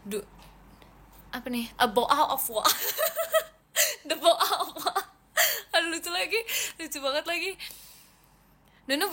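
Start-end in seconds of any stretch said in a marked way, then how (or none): 1.8-2.6 exaggerated British glottal stops; playful British accent, t's dropped, breaking into laughter. Shown as a demonstration. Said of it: "Kenapa nih tiba-tiba British accent?". 4.1-5.1 higher, slow British glottal stops; British accent. Shown as a demonstration. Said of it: "Kenapa nih tiba-tiba British accent?". 5.7-7.5 higher, tickled voice; recovering from a laughing fit; amused. Real, not acted. Cracking up at own British accent.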